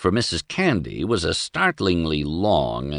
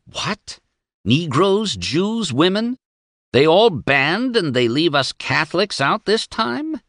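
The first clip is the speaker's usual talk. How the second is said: much higher, outraged and sputtering; indignant snort; 10 semitones higher than usual. A narrator's reading. The priest fuming over the fifteen points, indignant. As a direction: spit out the list in disbelief. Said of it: "He snorted".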